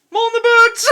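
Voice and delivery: high, loud comic exclamation; laughing, hammed-up shout to close the rant; mock shout. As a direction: bellow it like a punchline. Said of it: "I should have finished that with".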